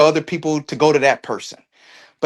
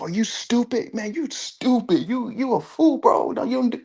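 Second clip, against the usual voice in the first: high, softer put-on voice scolding a fool; high mock chiding, kept soft; mocking onlookers' talk; 10 semitones higher, 5 dB quieter than usual. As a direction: imitate the onlookers in a light, high-pitched voice. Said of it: "that's how they talk".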